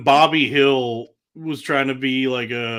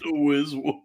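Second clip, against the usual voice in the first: softer echo of a comedian impression; Sam Morril voice; 5 dB quieter than usual. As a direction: repeat it softly with r as w. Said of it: "in Sam Morril voice".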